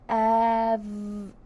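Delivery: Every drawn out sound by sound; stretched out slowly. Shown as a demonstration. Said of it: "Let's sound out every".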